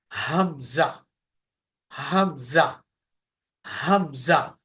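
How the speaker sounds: leading students to repeat हमजा with him; throaty hamza. Shown as a demonstration. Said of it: "गरज feel हो रही है".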